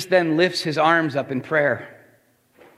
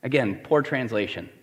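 ordinary talk, nothing marked

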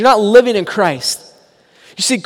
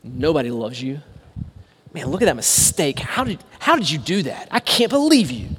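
softer, low accusing murmur; mock enemy whisper; 6 semitones lower, 7 dB quieter than usual. The enemy's voice accusing the listener, softly murmured. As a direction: hiss of the accuser, hushed. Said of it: "you'll hear whispers of like".

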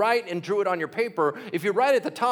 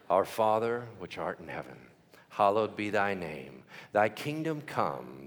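put-on old-school recitation; 5 semitones lower than usual. Shown as a demonstration. Preacher leading the congregation through the Lord's Prayer, old-school recitation. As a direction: recite in measured unison style, stretching each phrase. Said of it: "we're gonna go a little bit old school".

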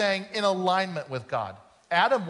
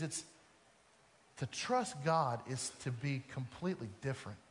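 hushed sermon; 3 semitones lower, 8 dB quieter than usual. Acted. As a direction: draw them in with a quiet, serious appeal. Quiet earnest preaching passage.

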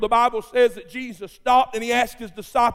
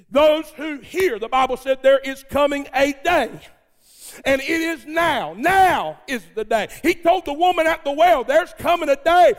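full-force preaching; 6 semitones higher than usual. Real, not acted. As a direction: build the crowd up, preacher style. Preaching climax.

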